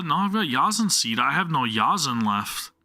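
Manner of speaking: ordinary talk, nothing marked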